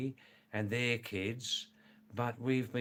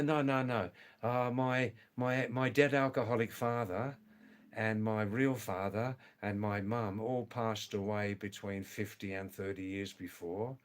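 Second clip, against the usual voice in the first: usual speaking voice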